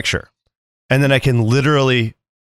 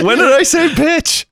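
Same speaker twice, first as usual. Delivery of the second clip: surprised laugh; 3 dB louder, slower than usual. Real, not acted. Amused disbelief at his own clip.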